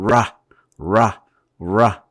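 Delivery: 라 slow with a curled tongue; 라 slowly, tongue folded up. Shown as a demonstration. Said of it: "라 이렇게 소리를 내보세요". (혀를 접어 천천히 내는 라)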